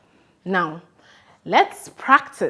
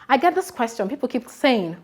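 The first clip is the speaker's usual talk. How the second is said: normal talking voice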